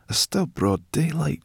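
high, hissing grumble; hissed complaint. A narrator's reading. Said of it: "she hissed".

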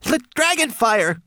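very high, loud creature voice; excited cry. A narrator's reading. Homunculus proposes dragon fire, crying out. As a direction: cry the answer out eagerly. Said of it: "cried Twigleg".

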